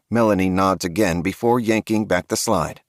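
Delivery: ordinary delivery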